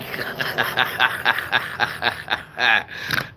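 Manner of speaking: loud staccato cackle, drawn out; long hearty laugh. Real, not acted. Streamer cracking up at his own Dutch, long cackle. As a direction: burst into a long rapid-fire laugh. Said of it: "A good laugh".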